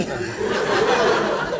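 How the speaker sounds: high shrieking laugh. Real, not acted. Panellist shrieks with laughter at the cold saddle answer.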